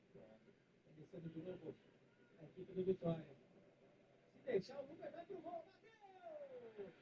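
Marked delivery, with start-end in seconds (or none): 4.3-6.9 explosive goal commentary. Acted. Live goal call on stream.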